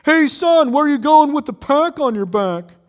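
loud, high, jeering call from the back; a bully's loud sneering shout; mocking heckler. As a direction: taunt the boy from across the room. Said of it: "One of them called out".

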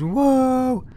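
imitating a kid. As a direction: mimic a startled little kid jumping. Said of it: "oh no, he goes".

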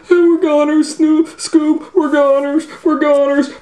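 high, loud scared Shaggy voice; high frightened whine. Acted. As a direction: wail it like a terrified teen. Shaggy hears footsteps.

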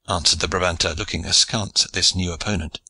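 plain reading voice

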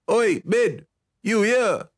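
put-on high nasal boy's call. A narrator's reading. Rancher's son looking for his friend, calling out nasally. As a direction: call out for a friend through the nose. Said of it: "Yalaric's nasal voice called out".

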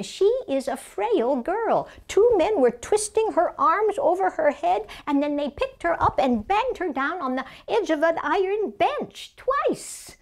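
high-pitched, alarmed storytelling; Mary Nolan, agitated recounting. Acted. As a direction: relive the attack as you tell it. Memoir, witnessing violence.

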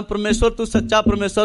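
usual speaking voice

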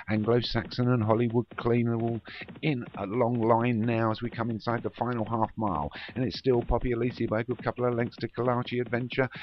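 building excitement, pitch climbing steadily; live race commentary. Real, not acted. Commentator calling the closing stages, increasingly excited. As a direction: drive the call on as the finish nears.

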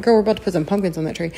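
rattled off fast to a friend; fast retold quip. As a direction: blurt it out quickly, teasing. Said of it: "I'm like".

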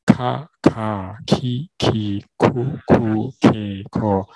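slow, separated syllables: 卡卡ki ki ku ku ke ko; syllable drill. Shown as a demonstration. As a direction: drill 卡卡ki ki ku ku ke ko slowly like a teacher. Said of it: "同样的，第二组".

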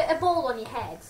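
ordinary delivery

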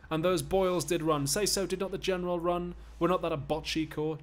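high, loud stage taunt. Acted. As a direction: needle your master at full voice. Slave taunting master in play.

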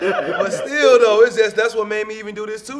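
laughing. Real, not acted. Laughing through his answer.

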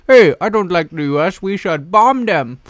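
high, loud caricature voice; crazy plotter. Acted. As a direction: put on a cartoonish plotter's voice. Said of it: "some crazy Afghan people".

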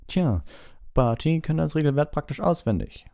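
beaming, high-pitched cheer; Bagman. A narrator's reading. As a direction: beam as if it's all settled. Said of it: "strahlte Bagman". (strahlend, mit hoher Stimme)

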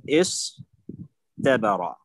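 استبر split slowly as is-tabara, light t after the s; original form with ta. Shown as a demonstration. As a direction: sound out استبر with a plain t, pausing after is. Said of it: "هذا هو الأصل".

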